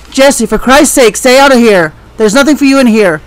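ordinary talk

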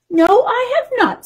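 put-on high-pitched old lady character. A narrator's reading. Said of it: "said the old lady".

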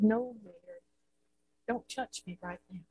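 softly, almost breathless, holding her back; hushed Jesus. A narrator's reading. Jesus stops Mary.

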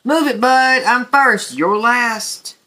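grocery truck shouting to get ahead; pushy shout. A narrator's reading. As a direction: bark at the little truck. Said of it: "gave his horn a blast".

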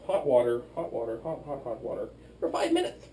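ordinary talk

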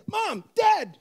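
high mock call to parents upstairs; mock yell downstairs. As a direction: call out to mom and dad from another floor. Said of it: "So you yell downstairs".

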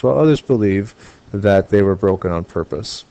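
normal talking voice

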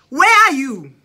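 loud, high demand down the phone; shouting where are you into the phone; frantic shout. Retold or imitated. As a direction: yell it at him. Calling dad, frantic.

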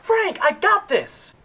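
slow, loud, exasperated; annoyed shout. Acted. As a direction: hold back anger, spell it out. Angrily tells angel back off.

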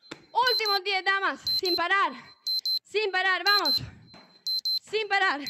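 rally the class through the end; coach shouting. Real, not acted. Coach urging class, final reps.

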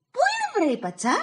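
very high, sharp angry question; angry demand. Acted. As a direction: snap at the son. Η μάνα ρωτάει θυμωμένη. (θυμωμένη μάνα ρωτάει απότομα, ψηλά)

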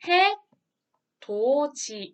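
해, 도, 지 spaced out, ending in a j sound; 해돋이 sounded out as 해-도-지; palatalized, syllable by syllable. Shown as a demonstration. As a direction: say "hae... do... ji" one syllable at a time. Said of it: "이렇게 발음을 하죠".

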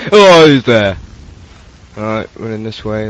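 ordinary talk

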